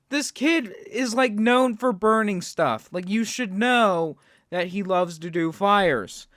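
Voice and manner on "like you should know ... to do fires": usual speaking voice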